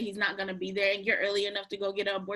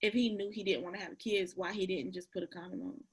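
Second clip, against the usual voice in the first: quiet; 3 semitones lower, 12 dB quieter than usual. Real, not acted. S2 speaks very softly.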